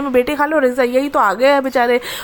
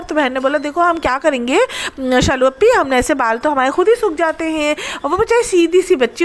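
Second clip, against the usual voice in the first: ordinary talk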